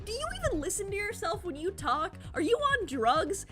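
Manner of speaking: ordinary talk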